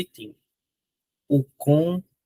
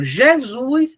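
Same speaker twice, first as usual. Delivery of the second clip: higher and louder cry of the name; blind man's cry; 7 semitones higher, 4 dB louder than usual. Retold or imitated. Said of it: "Quando o cego gritou". (grito do cego, mais alto)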